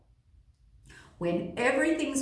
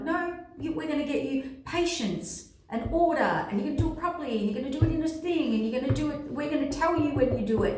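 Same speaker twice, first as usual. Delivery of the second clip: high-pitched, hurried mock instructions; putting on a fussy, insistent voice; imitated bossy orders; 6 semitones higher, faster than usual. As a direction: rattle off the rules in a raised pitch. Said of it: "and they're like".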